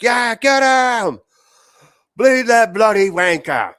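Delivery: put-on high, loud English-accented yell. Fan yelling at the armored fighters, shouted English accent. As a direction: bellow it like a rowdy English spectator. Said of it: "speaking in an English accent".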